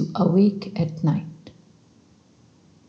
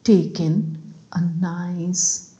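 slow, hushed sleep-meditation voice with long pauses; guided meditation; 4 dB quieter than usual. Acted. Guide leads listeners through breathing before sleep, slow soothing meditation. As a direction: lull them gently toward sleep, breath by breath.

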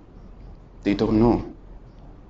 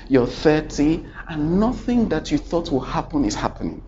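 usual speaking voice

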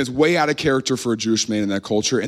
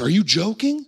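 high, rising mock-incredulous question; indignant disbelief; 7 semitones higher than usual. Angry crowd questions Jesus, indignant disbelief. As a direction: challenge them, acting affronted. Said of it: "they were angry".

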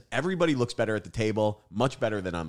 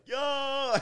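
excited shout-out; 3 dB louder than usual. Retold or imitated. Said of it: "and I'm like".

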